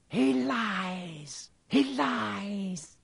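high, eerie, drawn-out accusation. A narrator's reading. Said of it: "a high voice spoke".

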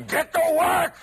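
shouted high and loud; booming command. Retold or imitated. Inner voice bursting out of the subway to push you to work, shouted. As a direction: bark the order like a voice from behind. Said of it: "comes up behind you and goes".

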